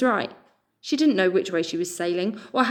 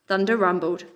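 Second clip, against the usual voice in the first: plain reading voice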